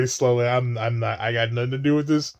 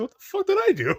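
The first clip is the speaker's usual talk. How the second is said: high mock Obi-Wan; faster than usual. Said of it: "Obi-Wan's across the galaxy".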